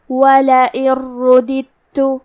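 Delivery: slow, careful Quran recitation of the phrase; tajweed recitation. Shown as a demonstration. Teacher demonstrating recitation to students, slow and measured. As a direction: recite it slowly with the merged dal. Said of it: "کلمت رددت میں یہاں دو دال ہے".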